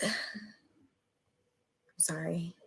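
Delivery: soft tearful whisper after a sigh; whispered apology. Real, not acted. Survivor apologizing mid-story, whispering through tears. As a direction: barely voice the word, close to tears. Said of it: "I'm a little emotional".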